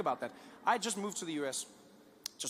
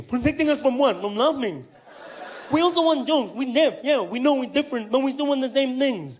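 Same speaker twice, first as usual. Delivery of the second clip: deaf man's speech; 6 semitones higher, 10 dB louder than usual. Retold or imitated. Deaf fan argues back.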